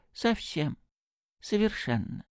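high, lightly musing afterthought; thoughtful. A narrator's reading. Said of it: "прибавил он задумчиво". (задумчиво, высоким голосом)

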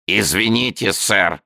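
a small creature apologising with a creak; creaky, polite amphibian voice. A narrator's reading. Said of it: "сказал скрипучий голос".

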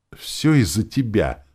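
high-pitched reproachful call; accusing cry. A narrator's reading. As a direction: cry it out in blame, drawn out. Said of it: "крикнула эльфийка".

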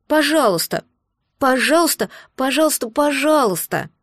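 put-on eager childlike begging; pleading baby dragon. A narrator's reading. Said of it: "радостно добавил". (радостная мольба дракончика)

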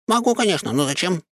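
proud, high squirrel boast; proud exclamation. A narrator's reading. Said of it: "гордо воскликнул он".

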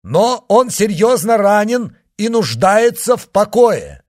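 high, loud public announcement; shouting to a crowd. A narrator's reading. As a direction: project every word to the street below. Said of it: "крикнул он". (громкое обращение к толпе)

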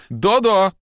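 loud high shout up at the ceiling; mother bellowing for her daughter upstairs; deafening yell. A narrator's reading. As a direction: yell upstairs at full force. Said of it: "оглушительно закричала в потолок".

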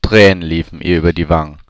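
ordinary delivery, nothing marked

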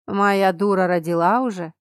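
usual speaking voice

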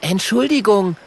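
Kokosnuss's voice. Acted. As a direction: apologise high like a little dragon.

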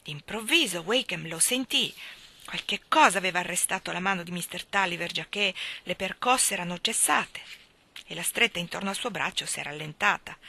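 ordinary delivery, nothing marked

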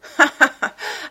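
high, loud, bitter cackle; short scornful burst of laughter. A narrator's reading. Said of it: "eut un rire amer".

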